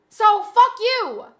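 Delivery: slow, loud, drawn-out curse; defiant telling off. Real, not acted. Streamer tells her mocking chat off, slow and loud. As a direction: spit it out slowly and loudly.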